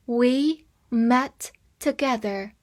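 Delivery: slow, in two chunks; slow reading. Shown as a demonstration. Teacher reading slowly to students, in chunks. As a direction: read it slowly for learners. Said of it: "slow reading after full sentence".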